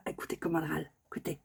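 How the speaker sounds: soft unvoiced whisper; hushed listen-closely whisper. Real, not acted. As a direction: whisper so they hear the bird. Hushed so bird is heard.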